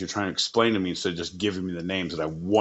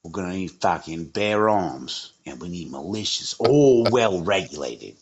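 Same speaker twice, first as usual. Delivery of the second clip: lowered, slowed mock English gangster voice; criminal accent; 2 semitones lower than usual. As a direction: growl it like a cockney villain planning a job. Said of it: "every criminal who does some shit is like".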